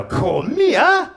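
high, loud, slow outraged "Kom igjen?"; hammed-up indignant Norwegian phrase; mock outraged. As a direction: bellow it like a shocked shopper. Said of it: "just go".

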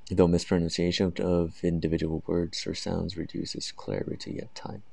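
slow, careful rubric reading; very slow, deliberate reading of criteria; reading aloud. Shown as a demonstration. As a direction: read the descriptor out word by word. Reads band descriptor aloud.

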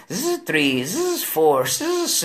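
ordinary talk, nothing marked

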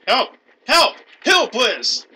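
high, loud repeated cries; calling for help. Real, not acted. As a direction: shout for a teammate's help. Calls teammate for help in game.

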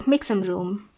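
ordinary talk

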